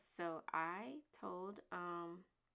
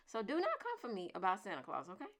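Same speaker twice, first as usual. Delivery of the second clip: ordinary delivery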